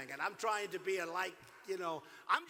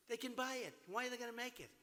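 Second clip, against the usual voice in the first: usual speaking voice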